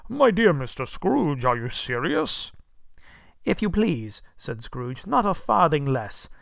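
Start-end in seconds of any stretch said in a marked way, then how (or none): 0.1-2.5 loud, high, slowed disbelief; breath-taken astonishment. A narrator's reading. Old gentleman doubts Scrooge's generous offer, astonished. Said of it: "as if his breath were taken away". 3.4-4.2 higher, louder, emphatic courtesy. A narrator's reading. Scrooge confirms his gift to the gentleman, earnestly. 5.1-6.3 high, loud, emphatic insistence. A narrator's reading. Scrooge insists on his gift to the gentleman, emphatically.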